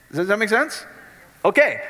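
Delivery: normal talking voice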